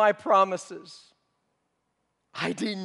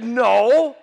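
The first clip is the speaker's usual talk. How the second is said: P=A; loud denial; 5 semitones higher, 13 dB louder than usual. Acted. Denying being a disciple.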